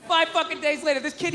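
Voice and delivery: usual speaking voice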